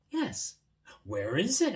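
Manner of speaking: loud, high questioning; eager boy. Acted. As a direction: ask eagerly. Boy and mole dialogue.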